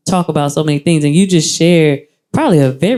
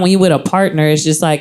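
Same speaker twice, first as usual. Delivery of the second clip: ordinary talk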